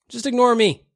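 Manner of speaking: ordinary delivery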